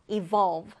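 "evolve" with VOLVE stressed; second-syllable stress. Shown as a demonstration. Teacher introducing the next word, stress on second syllable of "evolve". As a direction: go light on the "e", land on "volve" in "evolve". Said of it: "두 번째 음절의 강세 두고요".

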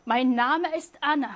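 German read out very slowly, word by word. Shown as a demonstration. Teacher reads a German example sentence slowly for students. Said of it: "이런 문장인데요".

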